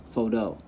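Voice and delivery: PHOTO said foh-TOH, weight on the end; stress on last syllable. Shown as a demonstration. Teacher repeating the wrong option, photo stressed on TOH. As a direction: lean on the final syllable of photo. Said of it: "or you could say".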